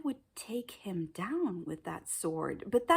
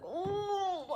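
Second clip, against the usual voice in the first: freaked out whimper; 10 semitones higher, 3 dB quieter than usual. Retold or imitated. I was so freaked out.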